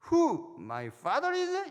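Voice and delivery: high, very slow, drawn-out English taunt; a schoolgirl stretching out each word in English to challenge her teacher; defiant little girl in English. Retold or imitated. Schoolgirl defies her teacher in English, high and slow. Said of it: "영어로".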